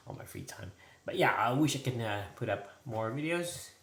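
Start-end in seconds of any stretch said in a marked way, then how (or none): 0.1-0.7 whisper. Real, not acted. Aside while chatting at computer.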